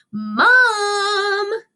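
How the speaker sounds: high, loud call for mom; shouted call. A narrator's reading. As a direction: yell up to mom together. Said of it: "Buddy and Don shouted together".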